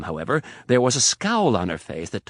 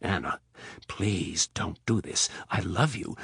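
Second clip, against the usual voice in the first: soft plea; 3 semitones lower, 5 dB quieter than usual. Acted. Rapp pleading softly.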